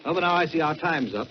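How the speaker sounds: ordinary delivery, nothing marked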